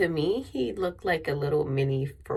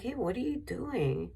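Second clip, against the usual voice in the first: retold yell; 7 semitones higher, 4 dB quieter than usual. Woman challenging the man with the dog, mild mock yell. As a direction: call out a challenge, pitched up, without real volume. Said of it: "I yelled at him".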